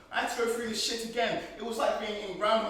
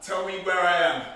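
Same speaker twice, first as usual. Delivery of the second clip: loud, hard demand; angry demand; 8 dB louder than usual. Acted. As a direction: bark the question at him. Captive demands answers.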